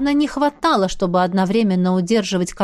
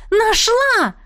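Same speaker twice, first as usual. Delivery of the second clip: звонко и высоко, громче обычного; радостно выкрикни находку; громкий возглас; 13 semitones higher, 3 dB louder than usual. Acted. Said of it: "громко воскликнула я". (высокий громкий вскрик)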